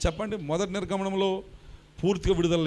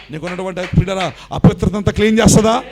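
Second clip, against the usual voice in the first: questions climbing louder and higher; rising sermon questions; 5 semitones higher, 14 dB louder than usual. Real, not acted. Pastor asking the church, building to a preaching shout. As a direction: build the fire with each question.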